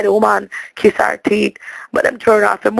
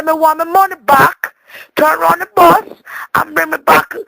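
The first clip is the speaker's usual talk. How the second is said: raised, higher pitched demand for the refund; indignant demand; 6 semitones higher than usual. Retold or imitated. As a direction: insist on the money back, voice up. Demanding money back on bus.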